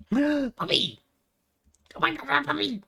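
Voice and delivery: high, thrilled squeal; excited squeal. Real, not acted. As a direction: squeal with delight at a puppy. Spots a puppy in game.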